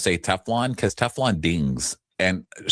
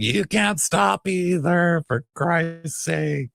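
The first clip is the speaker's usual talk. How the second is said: slow, higher exasperated line; drawn-out fed-up voice; mock exasperated retort; 8 semitones higher, much slower than usual. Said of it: "he's like".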